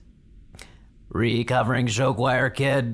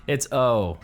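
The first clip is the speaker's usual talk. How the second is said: ordinary talk, nothing marked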